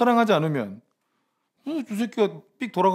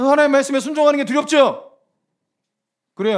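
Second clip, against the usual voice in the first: usual speaking voice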